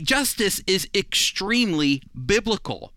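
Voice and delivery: normal talking voice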